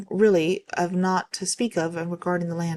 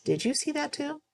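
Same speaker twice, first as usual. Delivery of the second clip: much higher, softer voice, rising; startled hushed question; 9 semitones higher, 5 dB quieter than usual. Retold or imitated. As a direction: imitate a tour guide checking what they both saw. Startled tour guide asks narrator.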